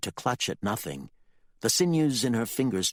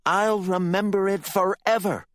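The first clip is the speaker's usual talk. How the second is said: higher, louder vow; defiantly; 13 semitones higher, 6 dB louder than usual. Acted. Candy defies her father, louder vow. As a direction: defy him to his face. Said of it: "she said defiantly".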